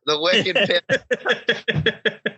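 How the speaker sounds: slow, stretched Boston "wicked pizza", the "wicked" drawn long; mock a Boston local, dragging out "wicked"; drawn-out Boston accent. Repeats, drawing out Boston wicked.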